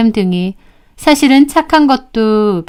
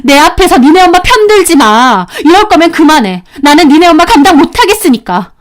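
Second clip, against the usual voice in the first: higher, forceful outburst; fed-up wife at breaking point; angry ultimatum; 6 semitones higher, 4 dB louder than usual. Acted. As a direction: lay down the law to him. Wife gives husband an ultimatum.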